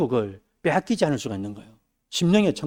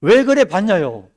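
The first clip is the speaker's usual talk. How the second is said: complaining believer; 4 semitones higher, 7 dB louder, slower than usual. Retold or imitated. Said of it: "불평과 원망으로요?".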